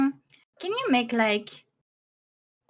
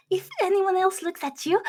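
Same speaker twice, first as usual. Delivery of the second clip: normal talking voice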